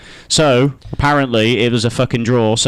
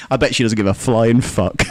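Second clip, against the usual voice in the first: ordinary delivery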